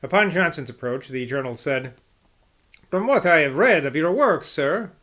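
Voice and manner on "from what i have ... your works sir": lilting, sing-song Italian accent; attempted Italian accent, pitch swinging up and down. Shown as a demonstration. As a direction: put on a bouncy Italian accent. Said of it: "not gonna do that Italian accent".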